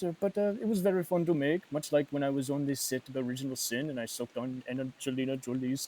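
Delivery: rambling, put-on Spanish accent; hesitant, hammed-up Spanish actor voice; Antonio Banderas impression. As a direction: reminisce as a Spanish film star. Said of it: "that Antonio Banderas impression".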